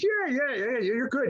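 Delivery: lightly acted, high-pitched enthusiasm; retold eager approval. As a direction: imitate an impressed studio guy. Retold producer approves the tape.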